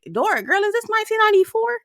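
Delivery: high, fast incredulous sass; sassy retort. Retold or imitated. Her retort to Alexis, high and incredulous. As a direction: toss it back like it's absurdly dated. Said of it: "I was like".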